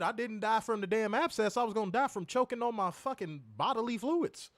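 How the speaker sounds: ordinary talk, nothing marked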